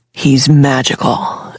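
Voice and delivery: ordinary delivery, nothing marked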